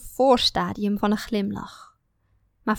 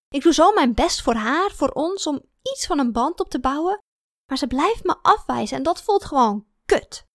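higher, emphatic complaint; frustrated venting; 6 semitones higher, 4 dB louder than usual. A narrator's reading. Woman venting to her sister about being rejected, frustrated. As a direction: pour out the hurt and frustration. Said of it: "Ik moest even uitrazen tegen iemand".

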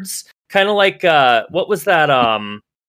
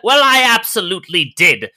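indignant Agnes; 7 semitones higher, 3 dB louder than usual. Acted. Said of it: "Agnes retort".

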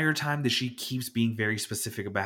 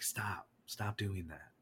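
near whisper, much softer; hushed aside; 3 semitones lower, 12 dB quieter than usual. Retold or imitated. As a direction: mutter it under your breath in disbelief. Telling a customer to stop.

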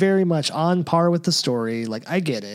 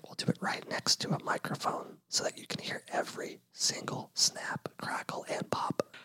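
mock ASMR whisper; 13 dB quieter than usual. Host promising listeners cereal sounds on mic, mock ASMR whisper.

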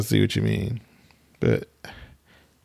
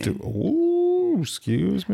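mock offended; 11 semitones higher, much slower than usual. Mocking partner's huffy excuse me.

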